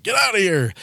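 hammed-up Jesus with a whip; mock angry shout. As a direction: drive them out of the temple with a shout. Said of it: "That's a reference to Jesus Christ".

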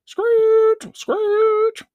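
high imitated tire screech; screeching brakes. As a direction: make the squeal of car brakes with your voice. Car brakes imitated in story.

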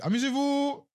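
very high, airy send-off; mock dismissal. Clerk brushing off the manager threat, high mock send-off. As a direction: wave it away in a high-pitched voice. His dismissive reply to customer.

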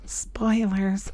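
whispered aside. Real, not acted. Teasing listeners about spoilers, whispered. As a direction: breathe it softly as a secret.

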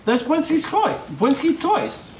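high squeaky Cockney voice; Cockney accent. Acted. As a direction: repeat it brightly in Cockney. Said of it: "doing this Cockney accent".

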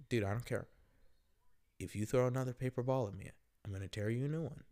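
quiet, unmoved warning, retold; calm, cold threat. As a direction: issue the threat softly and coldly. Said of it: "I said it calm, and I said it coldly".

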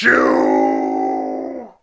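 drawn-out deep villain line; deep slow growl. Acted. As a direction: drag it out deep and menacing. Hangs up insulting.

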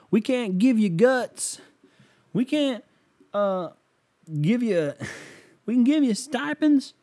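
very high, strained southern-coach put-on; Dabo. Football coach addressing recruits, hammed-up high voice. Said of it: "I also love Dabo".

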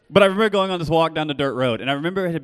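loud, high storytelling push; fervent preaching. Real, not acted. Preacher telling the congregation about the walk, loud.

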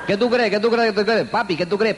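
high, laughing mock wife voice; mimicking chatty wife. Husband imitates his wife chattering on the long drive, high and giggly. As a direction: play the wife asking it over and over, giggling.

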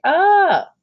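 loud, high-pitched scream; mock scream. A narrator's reading. Imitating Jamie running away from August, mock scream. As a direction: let out a kid's shriek, far above speaking pitch. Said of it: "he had run away from August, going".

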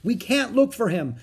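high, urgent protest; shouting. A narrator's reading. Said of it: "shouted Jack".

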